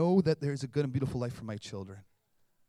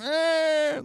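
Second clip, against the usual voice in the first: put-on high squeaky baby grunt; tiny high baby noise; 13 semitones higher, 8 dB louder than usual. Baby grunting when nudged.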